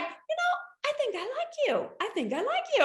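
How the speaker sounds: high, sing-song imitation of a charmed man; lightly mock the owner warming to her; playful impression of the owner saying he likes her; flirty shop owner. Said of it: "And then he's like".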